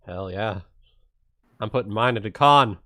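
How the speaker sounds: ordinary talk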